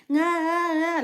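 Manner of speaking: high, loud mock wail; imitated baby cry. Mother showing viewers how babies usually cry, imitated baby wail. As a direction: wail like an ordinary crying baby. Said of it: "like babies usually cry".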